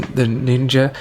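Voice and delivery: usual speaking voice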